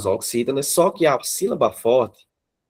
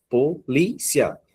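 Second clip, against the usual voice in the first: slow "Polícia" split as po, li, cia; "Polícia" in three slow, distinct beats; syllable by syllable; 3 semitones higher, much slower than usual. Shown as a demonstration. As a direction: separate PO, LI, CIA with pauses. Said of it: "é separar as sílabas".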